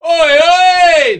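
high, loud Essex shout; loud Oi oi call. Retold or imitated. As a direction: yell it like a heckler. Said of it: "if anyone goes".

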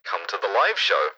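flat, quiet robot voice; monotone machine announcement. Pretend. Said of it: "I can do the robot voice or the megaphone voice".

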